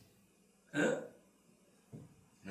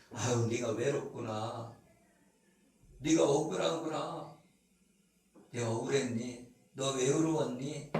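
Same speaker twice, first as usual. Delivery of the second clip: soft, low consoling voice; tender comfort; 6 semitones lower, 7 dB quieter than usual. Acted. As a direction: gently soothe someone hurting. Acted comforting of lonely person.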